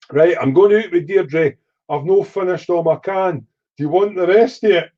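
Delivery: a hint of mum heading out for the night; mum's voice.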